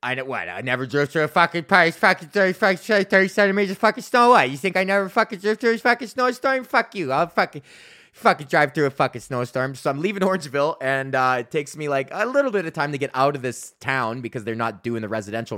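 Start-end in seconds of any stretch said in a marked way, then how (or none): 0.5-9.7 mock angry rant. Mock rant at imagined doubters.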